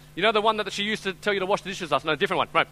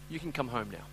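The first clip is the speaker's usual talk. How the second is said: soft invitation; 6 semitones lower, 10 dB quieter than usual. Real, not acted. Altar call appeal.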